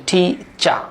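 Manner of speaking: টিচা with the final r silent; British pronunciation. Shown as a demonstration. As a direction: say টিচা ending in an open "ah", no r. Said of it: "টিচার বলা যাবে না, এটা হবে".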